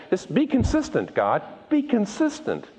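high, pressing demand; insistent Moses. Acted. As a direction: hold God to his word. Moses demanding God be consistent.

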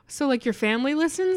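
high, rising doubtful question; skeptical sponsor. Pretend. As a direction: play the unimpressed brand rep probing.

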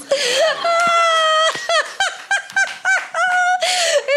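high, strained laugh breaking into crying; laugh turning to sobs. Real, not acted. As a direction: laugh through tears. Laughing while near tears.

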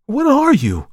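very high, loud question; alarmed cry. A narrator's reading. Markheim demands what the visitor is, alarmed cry. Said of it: "cried Markheim".

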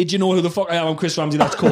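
ordinary talk

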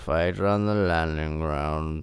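low, slurred droning speech; droning Churchill. Retold or imitated. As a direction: drone it like an old drunkard. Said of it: "it's more drone".

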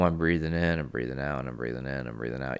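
a calming breathing mantra, trailing off; slow calm breathing. Shown as a demonstration. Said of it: "I'm super calm".

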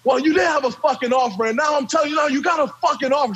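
high, fast shouting coach; retold screaming coach. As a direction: shout the offer at him. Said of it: "we're having a screaming match".

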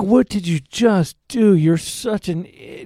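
high, slow, drawn-out scolding; exasperated with yourself, retold; mock self-reproach. Actor berating himself in the car, exasperated.

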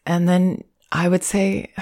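normal talking voice

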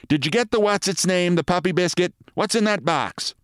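high, complaining wife's voice; nagging wife. Acted. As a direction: scold your husband in a high voice. Wife interrogates her husband.

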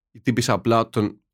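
ordinary talk

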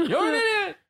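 high, laughing, near-crying squeal; squealing insult. Acted. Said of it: "is the actual correct sound".

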